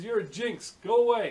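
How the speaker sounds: high, loud playful yell; joking shout. Retold or imitated. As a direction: yell at a pal, half laughing. Shooing the marshal away.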